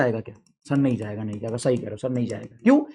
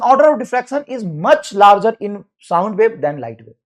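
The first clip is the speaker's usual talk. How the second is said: dictation; 4 dB louder, much slower than usual. Shown as a demonstration. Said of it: "dictating definition".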